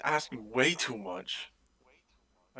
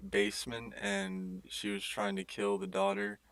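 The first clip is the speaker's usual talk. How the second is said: ordinary talk, nothing marked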